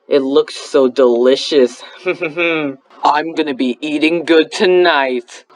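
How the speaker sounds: low, slow, hungry villain; greedy villain voice. Acted. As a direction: savor it like a predator. Hungry villain eyeing prey.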